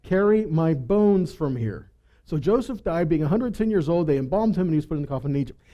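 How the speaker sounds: ordinary delivery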